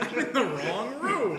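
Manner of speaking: dawning dismay, laughing; hammed-up wrong-room realisation; mock realisation. Mock teacher realising mistake.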